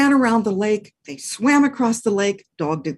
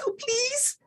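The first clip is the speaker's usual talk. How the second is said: very high, soft begging; a small sweet please; high soft puppy plea; tiny plea; 7 dB quieter than usual. Acted. Dog begs.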